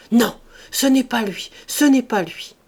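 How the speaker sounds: loud, emphatic repeated denial; crying out. A narrator's reading. Servant denying that Jacques is her lover, crying out. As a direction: insist on it with a cry. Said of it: "Elle s'écria".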